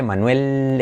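Manuel ending in a dark L like manwel; English dark L. Shown as a demonstration. Teacher models Manuel with an English dark L. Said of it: "en inglés es O".

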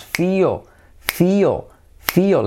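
"feel" stretched almost into two syllables, "fi-o". Shown as a demonstration. Teacher drills "feel" as nearly two syllables. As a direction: draw "feel" out so the L adds a beat. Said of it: "es casi como dos sílabas".

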